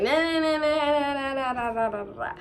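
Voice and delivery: singsong mocking nah nah. Mocking girl's refusal.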